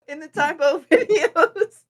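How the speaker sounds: laughing through the words, high; giggling while talking. Real, not acted. Laughing while telling story.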